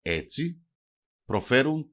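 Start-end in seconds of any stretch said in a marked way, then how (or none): none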